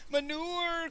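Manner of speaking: high, long-drawn mournful hawker's call, softened; street cry. A narrator's reading. Peasant hawking manure in the street, crying out. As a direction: sing it out high and sorrowfully. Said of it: "cried the peasant".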